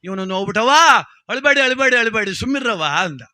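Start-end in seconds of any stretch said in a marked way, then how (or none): 1.3-2.8 high, repeated acted command; loud hushing. Dasappa telling the women not to cry, loud hushing. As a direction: call out to quell the wailing. Dasappa orders mourners to stop crying.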